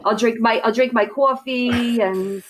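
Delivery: drawn-out Long Island vowels; slow, showy Long Island accent on "coffee". Shown as a demonstration. Guest demonstrating her home accent to host, Long Island accent. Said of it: "talk like this".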